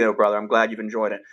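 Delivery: ordinary delivery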